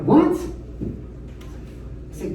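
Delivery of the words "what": high confused what. Retold or imitated. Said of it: "She's like".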